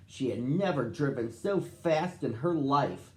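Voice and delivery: ordinary delivery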